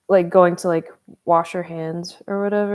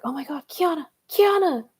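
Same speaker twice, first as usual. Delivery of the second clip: calling out; 9 semitones higher than usual. Retold or imitated. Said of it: "calling for me".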